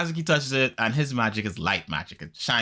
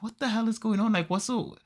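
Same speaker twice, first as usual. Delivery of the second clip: mock confusion; 10 semitones higher, 4 dB quieter than usual. Retold boy confused, dragged away.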